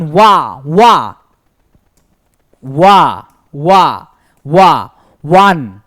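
ওয়া repeated low and slow before ওয়ান; teacher showing one starts with a wa sound; drawn-out wa sound. Shown as a demonstration. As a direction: sound out ওয়া again and again, then join ওয়ান. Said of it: "কি হচ্ছে উচ্চারণ?".

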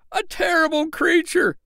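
high, dramatic cutting in; eager interruption. Acted. Tinker cuts in on the tailor before the crowd, dramatic. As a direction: grab the story back. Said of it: "interrupted the tinker".

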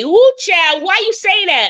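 high-pitched teasing mimicry; mocking imitation. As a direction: mimic the catchphrase to poke fun, high. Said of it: "they be mocking me".

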